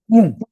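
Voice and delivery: punchy, loud imitated pop; a high, loud "pum" for a sudden result. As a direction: drop in an abrupt sound-effect boom. Imitates muscle suddenly appearing.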